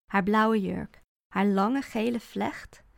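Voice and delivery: plain reading voice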